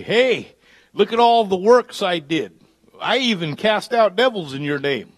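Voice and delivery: hint of a self-proud servant at judgment; boastful plea. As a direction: lightly play someone pleading their good works. Boastful soul before God.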